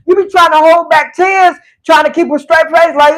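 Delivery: ordinary talk, nothing marked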